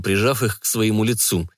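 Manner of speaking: ordinary delivery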